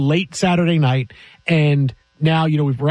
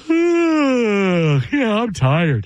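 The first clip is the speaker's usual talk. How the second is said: words through a yawn; sleepy yawning voice; 4 semitones higher, much slower than usual. Retold or imitated. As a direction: say it mid-yawn. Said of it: "just went".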